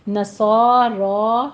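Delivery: نصارا with both alifs held two counts; long vowels. Shown as a demonstration. Teacher shows students the long vowels in نصارا. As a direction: draw out نصارا slowly. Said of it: "دونوں آپ نے ٹو ٹو count کرنے ہیں".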